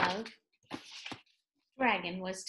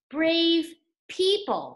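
proclamation; 6 semitones higher, 6 dB louder, much slower than usual. Acted. Reading sign as proclamation.

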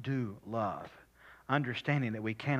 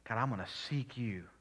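whispered prayer. Acted. Quiet personal prayer.

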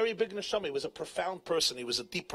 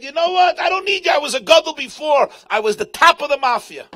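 much higher, shouted defiance; proud shout; 10 semitones higher, 12 dB louder than usual. Retold or imitated. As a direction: roar it out like the mafia chief he was. Rish Lakish proudly rejecting his teacher.

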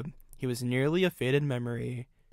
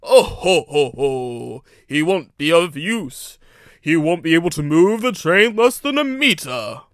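loud, drawn-out mocking ho-ho; bawling laughter; 3 semitones higher, 10 dB louder than usual. A narrator's reading. Gordon mocking the small engine's chances, loud laughing bawl. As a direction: boom out a scornful laugh and carry on jeering. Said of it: "bawled out in laughter".

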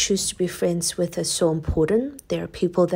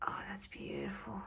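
whispering; 21 dB quieter than usual. Real, not acted. Admiring the finished candle.

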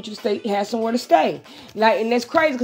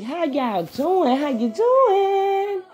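slow drawn-out greeting; 8 semitones higher, much slower than usual. Real, not acted. Streamer greeting viewers, slow and sing-song. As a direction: stretch each word out long, rising high.

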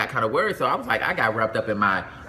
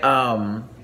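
usual speaking voice

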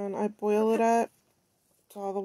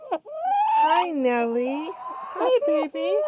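high, loud, sing-song coo; 9 semitones higher, 5 dB louder, much slower than usual. Real, not acted. Woman calling to her hen Nelly in a high cooing voice.

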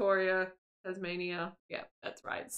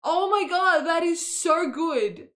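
loud, higher, drawn-out delight; excited gushing; 5 semitones higher, 6 dB louder than usual. Real, not acted. Gamer delighted by a game reference, loud gushing. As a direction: gush it loudly, leaning on "so".

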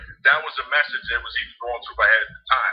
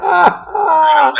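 loud high burst of laughter; laughing shout; 10 dB louder than usual. Real, not acted. Host cracking up at when do I get the money, loud laughing shout. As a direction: roar with laughter.

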